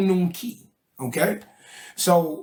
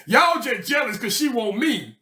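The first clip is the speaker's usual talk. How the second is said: much higher, shouted brush-off of his friends; cocky protest; 5 semitones higher than usual. Retold or imitated. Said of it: "He like".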